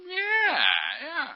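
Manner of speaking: high, drawn-out yeah; placating the sponsor. Acted. Street sweeper covering up to his watching sponsor, high drawn-out agreement. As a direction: stretch out a high, innocent yeah. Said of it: "looking at my sponsor going".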